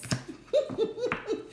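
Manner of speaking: high burst of real laughter; amused high laugh. Real, not acted. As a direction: laugh out at the message. Laughing at the card reply.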